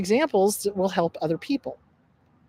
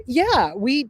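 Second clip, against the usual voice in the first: ordinary talk, nothing marked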